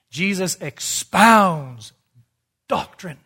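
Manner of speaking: "expounds" hugely drawn out, then "doctrine" set apart; very slow, weighty declaration with a long "expounds"; emphatic preaching. Real, not acted. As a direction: stretch "expounds", pause, then land "doctrine". Preacher drives home his point.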